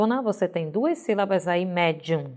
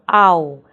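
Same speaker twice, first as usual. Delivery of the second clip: "au" set apart, loud and firm; stressed syllable; 3 semitones lower, 7 dB louder than usual. Shown as a demonstration. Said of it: "é a nossa sílaba tônica".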